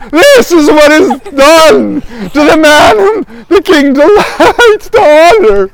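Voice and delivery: high, slow herald's shout; slow, high-pitched public proclamation. Acted. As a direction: proclaim it to the whole city, drawn out. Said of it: "shouting to, to everybody who can hear".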